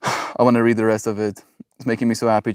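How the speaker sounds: usual speaking voice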